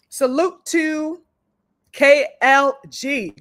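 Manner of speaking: slow, high, drawn-out shout-out; drawn-out salute. Real, not acted. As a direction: stretch the salute out like a welcome. Greeting a viewer joining the stream.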